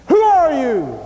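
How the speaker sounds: loud, high questioning, falling at the end; acted-out pressing challenge; insistent demand. Exasperated questioners pressing John.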